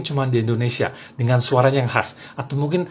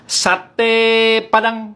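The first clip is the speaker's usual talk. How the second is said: "Sate" stretched high and long, "Padang" punched at the end; Padang satay cry; 7 semitones higher, 3 dB louder, much slower than usual. Shown as a demonstration. Padang satay vendor calling, long "Sate", stressed final "Padang". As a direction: hold "Sate", then hit "Padang" short. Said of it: "Padangnya ditekanin di terakhir".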